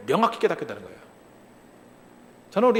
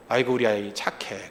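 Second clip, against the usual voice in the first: put-on lower, softer, slow praise for an obedient child; mom praising; 11 semitones lower, 6 dB quieter than usual. Pastor imitating a mom praising her quiet child, softly and slowly. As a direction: coo over the good little one, slowly. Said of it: "그럼 뭐라 그래요?".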